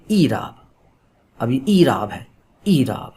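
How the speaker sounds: ایراب begun with a long 'ee'; maroof kasra pronunciation. Shown as a demonstration. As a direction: say ایراب as 'EE-raab'. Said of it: "یہ آواز دے گی ای کی".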